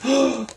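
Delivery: sharp surprised gasp. Acted. Narrator acting Charlie's shock, sharp gasp. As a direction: gasp in amazement.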